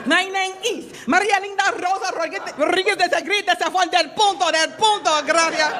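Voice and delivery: put-on heavy Spanish-accented English. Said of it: "I am Miss Florida".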